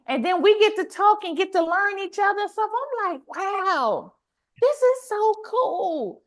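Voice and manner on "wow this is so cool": high-pitched, delighted wow; acted amazement. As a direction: draw it out, marvelling at it. Acts out own amazed reaction.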